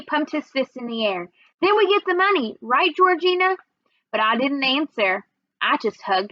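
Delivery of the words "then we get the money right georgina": put-on eager child voice. Eager little brother.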